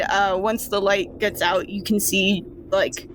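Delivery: ordinary talk, nothing marked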